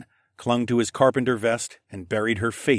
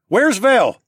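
high, loud, urgent question; alarmed shout; 13 semitones higher, 9 dB louder than usual. A narrator's reading. Bowden demanding where Vel is, shouting in alarm. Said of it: "Bowden shouted".

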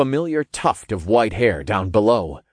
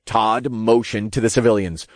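ordinary delivery

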